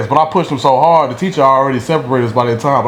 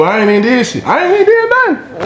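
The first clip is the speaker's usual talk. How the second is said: telling the listener, indignant and high; indignant outburst; 11 semitones higher than usual. Real, not acted. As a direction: voice shooting up high in protest. Outraged at unfair fight.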